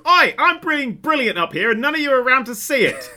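high, loud, gleeful showing-off cry; boastful eleventh Doctor. Retold or imitated. Mimicking the Doctor boasting to friends, loud and high. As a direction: crow it out like a smug genius. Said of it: "the Doctor's head just pops up and goes".

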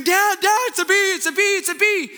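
put-on high, frantic cry of a terrified boy; boy screaming to his dad about the bee, high and panicked; panicked child's scream. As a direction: shriek it like a kid trapped with a bee. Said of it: "he's screaming".

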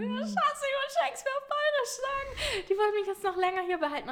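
high, whiny phone lament; whiny to Dani. Retold or imitated. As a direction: whine it to your partner on the phone. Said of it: "hab Dani angerufen". (hoch und weinerlich am Telefon)

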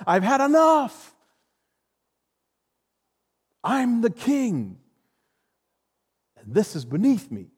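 high, loud royal disdain, slowing on the king; lofty king dismissing the squabble; haughty king. Pretend. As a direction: look down on them from the throne. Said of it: "I'm King Solomon. I'm going".

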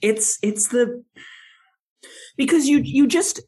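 high, loud excited rambling; bursting to explain. Real, not acted. Excited rambling about movies.

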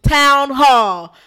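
loud punchy exclamation; emphatic. Real, not acted. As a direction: slam each word down. Listing what he destroyed.

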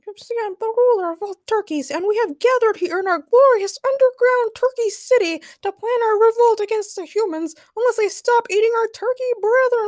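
very high, loud villain squeak; turkey ruler. A narrator's reading. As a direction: proclaim like a tiny tyrant.

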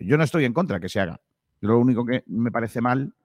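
ordinary talk, nothing marked

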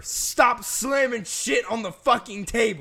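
loud, high, slow telling-off; real annoyed scolding. Real, not acted. Host scolding co-host over table noise, annoyed. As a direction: punch out each word in irritation.

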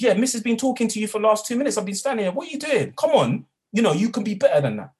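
high, loud scolding. Retold or imitated. Teaching assistant scolding the boys for ignoring Miss, loud. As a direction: tell them off sharply, high and loud. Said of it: "I raised my voice".

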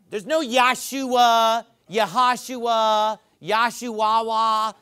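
each name form drawn out slowly and mockingly; slow, drawn-out list. Shown as a demonstration. Preacher mocking the invented name forms one by one. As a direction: drag out every false name. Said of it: "listing the false name forms slowly".

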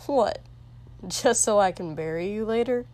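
loud, fast, incredulous sneer; scoffing outburst. Acted. King scoffs back loudly.

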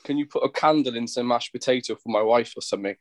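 usual speaking voice

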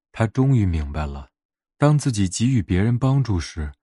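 ordinary delivery

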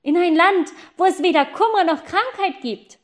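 high, loud answer; boy calling down to the girl, loud and high; calling back. Acted. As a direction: shout the answer down.

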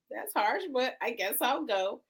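usual speaking voice

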